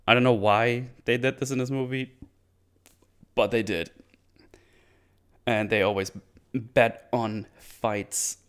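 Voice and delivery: usual speaking voice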